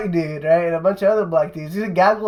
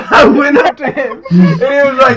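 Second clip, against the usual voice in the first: high, loud, breaking up with laughter; helpless giggling storyteller; laughing while talking; 6 semitones higher, 9 dB louder than usual. Real, not acted. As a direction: try to tell it through real laughter. Cracking up while telling the story.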